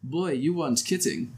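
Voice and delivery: put-on old German Fraulein voice; slipping German-accented character voice. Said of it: "like, an old German Fraulein".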